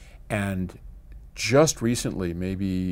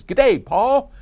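high, loud, hammed-up Australian greeting; playful Australian accent, louder and higher; mock Australian accent; 14 semitones higher, 10 dB louder than usual. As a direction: belt out an Aussie g'day, half-joking. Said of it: "I'm not doing your Australian accent".

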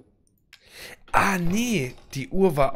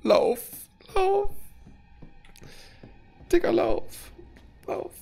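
frantic high yells to run; being chased; slower than usual. Real, not acted. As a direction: scream at yourself to run while fleeing. Fleeing a monster in game.

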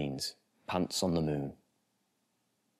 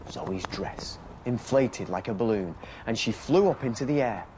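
put-on lively storybook reading for small children; bedtime story narration; 5 semitones higher than usual. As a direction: read it like a bedtime picture book.

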